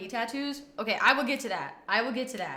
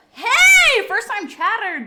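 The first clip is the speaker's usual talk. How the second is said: excited; 9 semitones higher, 12 dB louder, slower than usual. Real, not acted. Greeting new chatter.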